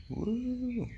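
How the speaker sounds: high, thrilled woo; excited whoop. Real, not acted. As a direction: let out a happy cheer way up high. Cheering about being in India.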